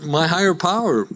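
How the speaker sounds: normal talking voice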